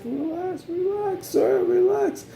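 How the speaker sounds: very high, pleading relax; mock soothing. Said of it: "I was like".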